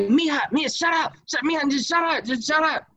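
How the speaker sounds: high, exasperated mock husband begging her to stop; imitating the husband. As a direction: squeak it out like a fed-up husband. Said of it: "And her husband's like".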